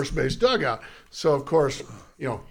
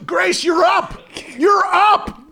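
high shouted call, rising; urgent shout to the batter; 6 dB louder, slower than usual. Retold or imitated. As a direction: holler it like a bat boy with no time left. Calling the batter up.

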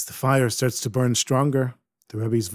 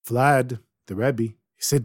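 usual speaking voice

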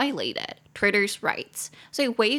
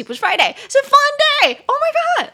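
loud, high squeal of dismay; shocked self-correction; 10 dB louder than usual. Real, not acted. Correcting her own wrong name, high loud embarrassed outburst. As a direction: blurt it out, shooting up high and loud. Realises she misnamed the company.

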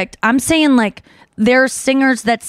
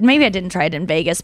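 ordinary delivery

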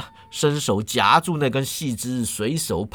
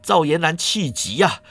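ordinary delivery

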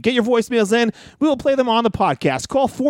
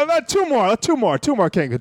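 ordinary talk

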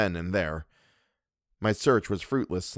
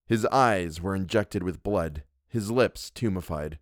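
plain reading voice

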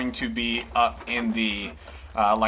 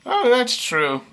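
high cook voice; 3 dB louder, slower than usual. Acted. Franklin voice.

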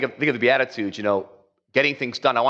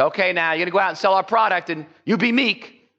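mock sales boss; 8 semitones higher than usual. Mock executive to sales force.

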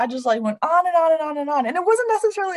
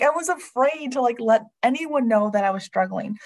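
ordinary delivery

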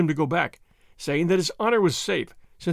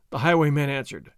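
usual speaking voice

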